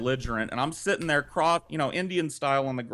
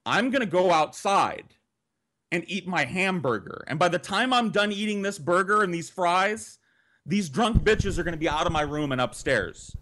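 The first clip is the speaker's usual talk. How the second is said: angry ultimatum; 6 semitones higher than usual. Retold or imitated. Ultimatum to drunk sister.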